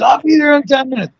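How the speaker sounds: high-pitched imitation of a late friend; mock repeated promise. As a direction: parody the same empty promise, pitched right up. Same promise two hours later.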